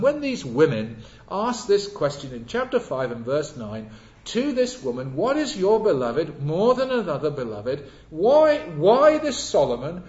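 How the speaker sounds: loud, insistent pulpit questioning; impassioned preacher voicing demanding questioners; preaching.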